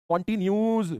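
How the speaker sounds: कंटीन्यूअस cut short to "kon-tin-yooz"; misspelling read aloud. Shown as a demonstration. Teacher reading out students' misspelling, कंटीन्यूअस mispronounced "continyooz". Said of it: "ऐसे भी लिखते हैं".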